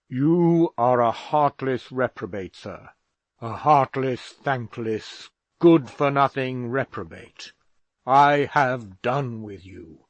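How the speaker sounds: disown him with contempt; father casting off his son in fury; angry father. A narrator's reading.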